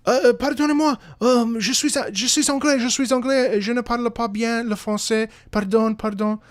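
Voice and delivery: high, loud, flustered French stammering; re-enacted terror. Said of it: "my French is completely gone out the window because I'm terrified".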